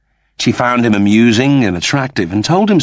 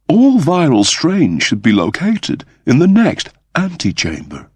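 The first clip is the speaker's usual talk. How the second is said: higher voiced woman, thoughtful; Kachansky's voice; 7 semitones higher than usual. Kachansky reasoning to Crichton about the ship, higher voice. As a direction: lift into a careful officer's voice, working it out.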